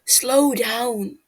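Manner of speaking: loud call to slow down. A narrator's reading. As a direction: call after the galloping prince. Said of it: "calling out behind him".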